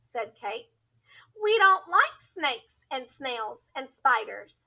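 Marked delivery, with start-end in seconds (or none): none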